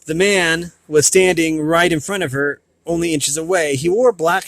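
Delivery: ordinary delivery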